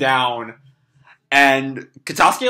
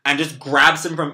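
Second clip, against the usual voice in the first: ordinary talk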